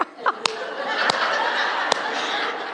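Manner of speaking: a long high laugh at her own pun; high airy laugh. Real, not acted. Cracking herself up at her funeral-home joke to the audience. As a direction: laugh high and breathy, delighted.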